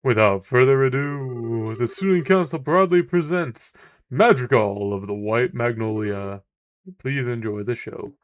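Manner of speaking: loud, slow formal presentation; stage announcer. Acted. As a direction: present the show to the hall. Presents the play onstage.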